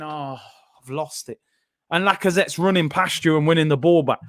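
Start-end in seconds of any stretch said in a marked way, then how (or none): 0.0-1.4 a hint of a careless shrug, soft and low; mock lazy striker. Mocking Aubameyang again after losing the ball, mild soft mimicry. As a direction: mimic a striker who can't be bothered. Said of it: "losing the ball and going".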